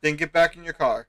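loud firm police order; stern command. Acted. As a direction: bark it like a cop. Officer repeats order.

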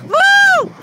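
a big high whoop; loud high whoop. Real, not acted. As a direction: whoop the car past. Car passes, fan whoops.

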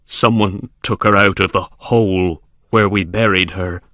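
horrified servant. A narrator's reading. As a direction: haltingly reveal the horror, rising in pitch at the end. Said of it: "wild with fear".

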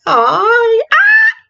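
rising wail into a shriek; high screams, letting it all out; gritos de desahogo. Real, not acted. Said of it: "Ya saqué todos los gritos". (gritos agudos de desahogo)

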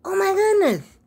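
high, loud delighted gasp of surprise; surprised oh my goodness. Retold or imitated. Grandma retelling finding the bag on the porch, delighted surprise. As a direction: exclaim it like finding a hidden gift. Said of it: "I said".

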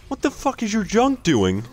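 very high incredulous squeal; baffled outcry. Real, not acted. Spectator to player about the junk, high baffled outcry. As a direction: shoot the pitch way up in disbelief.

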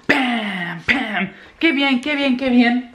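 high-pitched little cheer; playful celebration. Real, not acted. Vlogger celebrating for viewers, playful cheer.

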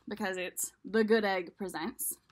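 plain reading voice

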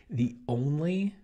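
"only" drawn out slowly and high; stress on only. Shown as a demonstration. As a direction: hold "only" long for emphasis. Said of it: "three times for emphasis".

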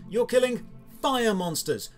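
ordinary talk

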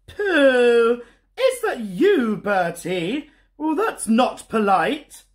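a prim mum, nose wrinkled at the smell; sniffy Mum. Acted. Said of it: "sniffed Mum".

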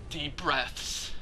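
soft, low, measured self-soothing; calming oneself. Real, not acted. Player calming down with deep breaths, hushed and low. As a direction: murmur it between pauses to settle down.

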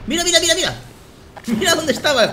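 high-pitched, excited discovery; excited exclaiming. Real, not acted. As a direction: call it out, breaking into a laugh. Spots the hidden enemy. (exclamando agudo, con emoción)

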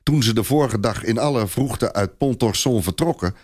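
ordinary delivery, nothing marked

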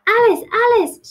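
ordinary delivery, nothing marked

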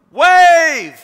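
loud high yelled call; friends yelling. Retold or imitated. Friends on the shore yelling to him, loud high call. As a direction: shout it across the beach. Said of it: "and they go".

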